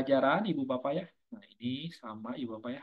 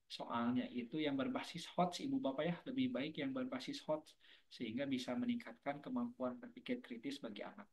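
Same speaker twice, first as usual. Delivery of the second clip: trailing off; 8 dB quieter than usual. Real, not acted. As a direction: voice fading away. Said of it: "suaranya makin hilang".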